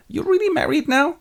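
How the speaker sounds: high-pitched mock amazement; hammed-up surprise, way up high; astonished question. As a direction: squeak it in disbelief. Iago surprised by the marriage.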